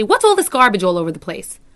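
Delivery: loud scolding snap at the class; harsh I tone. Shown as a demonstration. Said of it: "using an I tone will say".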